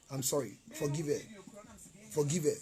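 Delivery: low, soft plea for forgiveness; hushed, low-pitched apologetic aside; subdued apology. Real, not acted. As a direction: drop right down and apologise quietly. Subdued apology on someone's behalf.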